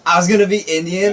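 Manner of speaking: high, loud, incredulous question; can't believe what he heard; shocked disbelief. Real, not acted. Shocked at being guessed Indian.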